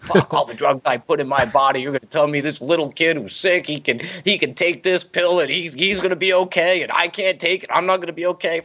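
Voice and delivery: high, heated biker rant; imitating the stepdad, high and worked up; stepdad's rant. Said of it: "he was like".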